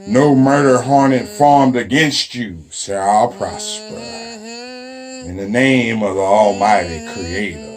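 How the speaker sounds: a lightly preached mock benediction; mock blessing.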